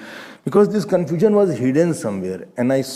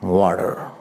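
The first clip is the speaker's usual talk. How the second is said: lower, brief American "Water"; American accent; 7 semitones lower than usual. Shown as a demonstration. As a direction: say "Water" like an American, R sounded at the end. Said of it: "if you're American".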